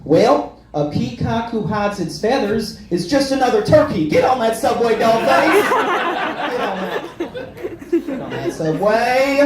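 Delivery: high, sassy Southern pep talk. Acted. Country star in his head urging him onto the subway, high and confident. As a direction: push them along with confident country twang. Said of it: "I just hear [name] in my head say".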